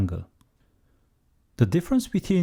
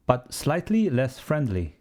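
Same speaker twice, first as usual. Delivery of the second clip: ordinary talk, nothing marked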